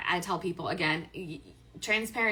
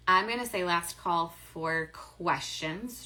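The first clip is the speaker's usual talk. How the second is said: usual speaking voice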